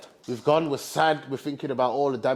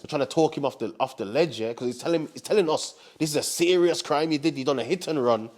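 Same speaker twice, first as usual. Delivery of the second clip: ordinary talk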